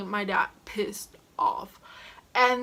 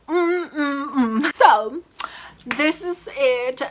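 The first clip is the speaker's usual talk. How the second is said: hammed-up whimpering sobs; fake-cry the ending; mock crying; 4 semitones higher, 6 dB louder, slower than usual. Mock sobbing at story end.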